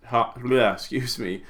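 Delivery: ordinary talk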